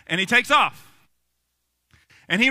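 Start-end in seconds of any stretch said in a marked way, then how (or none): none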